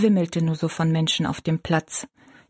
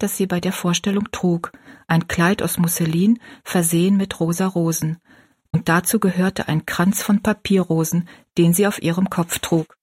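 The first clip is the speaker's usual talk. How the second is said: ordinary delivery, nothing marked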